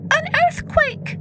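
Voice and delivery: very high mouse voice; high-pitched mouse, asking; questioning mouse. A narrator's reading. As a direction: squeak it out as a little mouse. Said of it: "then another asked".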